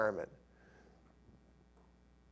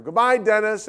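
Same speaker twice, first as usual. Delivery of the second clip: higher, louder farewell call; calling goodbye; 6 semitones higher, 5 dB louder than usual. Retold or imitated. Dad calling goodbye to friend across lot, raised call.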